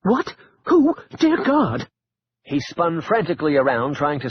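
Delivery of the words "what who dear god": high, panicked gasping cries; startled panic. Acted. Caleb startled by a hidden voice, panicked high cries. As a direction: spun around, frightened by a voice from nowhere.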